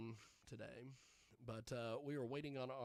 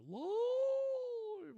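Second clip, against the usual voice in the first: much higher, louder, stretched-out word; high drawn-out howl; 8 dB louder than usual. Pretend. Host announcing the show is live, in a long high howl. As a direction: swoop up high and hold the word.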